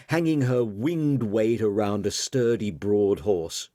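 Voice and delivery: plain reading voice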